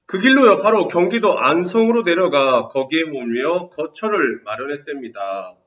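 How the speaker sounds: loud, bright reading of the passage; textbook reading. Acted. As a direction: read the story out to the class. Reads textbook passage aloud. (교과서 낭독하듯 크게)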